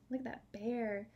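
normal talking voice

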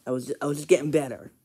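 ordinary talk, nothing marked

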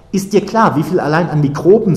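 high, loud scolding; indignant. A narrator's reading. Acted quarrel about being touched.

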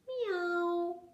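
high, soft kitty meow. A narrator's reading. Someone close by the puppy's ear, soft high meow. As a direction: meow gently and high, drawn out. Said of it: "hörte er wieder leise sagen".

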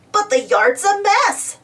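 a lightly voiced dismayed Muck; Muck crying out. A narrator's reading. Muck objecting to Bob's competition plan, dismayed. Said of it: "cried Muck".

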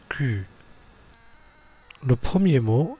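ordinary talk, nothing marked

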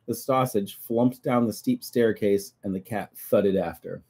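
lively read-aloud storytelling; story narration. A narrator's reading. Reading the Findus book to children, lively storybook voice. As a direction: read the book to a child, playing every part.

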